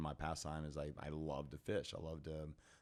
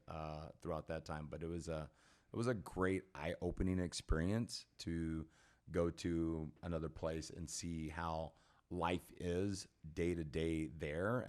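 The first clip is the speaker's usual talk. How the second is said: normal talking voice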